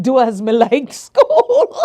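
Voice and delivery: slow, laughing Jamaican patois; Jamaican accent. Retold or imitated. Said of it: "I had to go into my accent".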